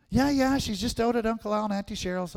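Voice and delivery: lightly voiced breezy mother; mom's answer. Mom answers her son casually, lightly voiced.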